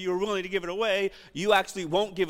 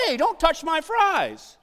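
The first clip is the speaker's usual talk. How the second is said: put-on high, slow kid protest; drawn-out, high mock protest; indignant son; 7 semitones higher, 3 dB louder, slower than usual. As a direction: imitate a son guarding his food. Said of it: "He said".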